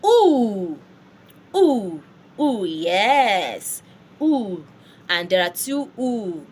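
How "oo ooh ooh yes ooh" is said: oo held long and loud; French OU sound. Shown as a demonstration. Teacher drilling how O U is pronounced, long French "oo". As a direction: Ooh as a long, rounded, loud vowel. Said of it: "O U is pronounced oo".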